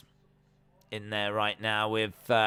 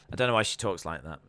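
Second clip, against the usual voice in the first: normal talking voice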